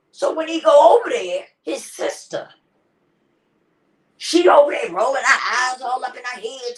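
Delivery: getting heated retelling the scene. Real, not acted. Heated retelling of funeral drama.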